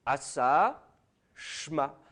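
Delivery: Asa then Shma, as a model reading; modelled pronunciation. Shown as a demonstration. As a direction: sound it out as the scribe read it. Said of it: "il prononçait Shma". (lecture modèle du scribe, Asa puis Shma)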